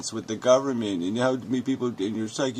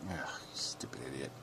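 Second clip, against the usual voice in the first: much softer, unvoiced aside; whispered insult; 8 semitones lower, 19 dB quieter than usual. Real, not acted. Muttering at the commenter, a soft disgusted whisper.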